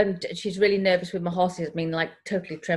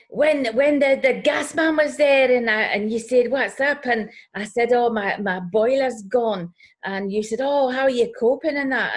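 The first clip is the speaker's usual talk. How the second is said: Scottish neighbour; 5 semitones higher, 5 dB louder than usual. Retold or imitated. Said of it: "she's Scottish, by the way".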